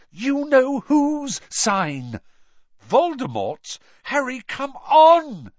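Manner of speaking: high, loud and drawn-out panic; panicked urging. Acted. Hermione pulling Harry from the Mark, panicked urging. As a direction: plead loudly, stretching the key words. Terrified girl urging friend to flee.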